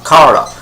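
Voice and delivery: Kara given as "KAH-ruh"; KAR-uh pronunciation. Retold or imitated. Imagined film crew answering the actress, Kara as KAH-ruh. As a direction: answer with Kara, first vowel as in car. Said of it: "How do you pronounce the name?".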